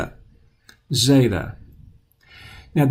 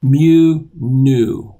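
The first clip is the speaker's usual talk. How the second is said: "Mu, nu" said louder as a contrast drill; 7 dB louder than usual. Shown as a demonstration. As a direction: set mu against nu, punching both. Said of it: "lowercase nu".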